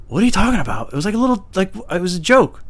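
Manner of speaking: high-pitched defensive pleading; incredulous protest. Retold or imitated. Retold protest to the bosses.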